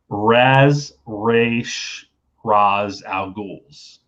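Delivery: Raz, Raish, Raz, each drawn out slowly; alternate pronunciations. Shown as a demonstration. Host reading a team's joke name, Raz Raish Raz slowly spelt out by sound. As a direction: separate RAZ, RAYSH and RAHZ before Al Ghuls.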